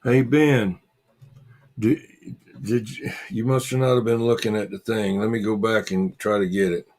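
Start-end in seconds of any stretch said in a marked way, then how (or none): none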